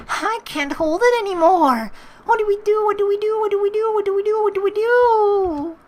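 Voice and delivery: put-on high, loud, panicky girl voice; Marina desperate. Marina begging the hero for help, high panicky voice. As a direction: keep pleading in a squeaky desperate voice.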